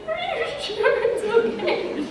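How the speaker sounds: high, slow, wobbly question through a laugh; teary laughing plea. Retold or imitated. Crying child asking her screen mom for reassurance, teary. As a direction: ask through half-laughing tears. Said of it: "And I was like".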